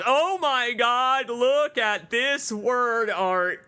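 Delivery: slow, high, amazed; delighted. Real, not acted. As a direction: savor the delight. Delighted at the word art.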